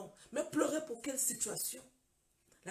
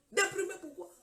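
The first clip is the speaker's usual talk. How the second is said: loud, raised-pitch challenge; retold incredulous protest; 7 semitones higher, 6 dB louder than usual. Patient told she is depressed, loudly incredulous. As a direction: throw the word back in acted disbelief. Acts patient rejecting diagnosis.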